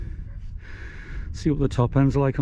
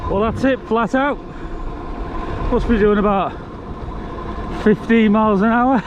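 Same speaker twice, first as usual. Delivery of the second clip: raised voice; 8 semitones higher, 5 dB louder than usual. Real, not acted. Shouting while riding flat out.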